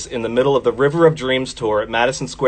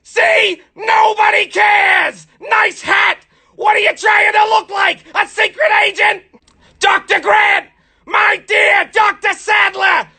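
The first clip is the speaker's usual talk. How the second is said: shouting movie quotes; slower than usual. Acted. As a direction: bellow each line like a crazed fan. Jurassic Park quotes shouted.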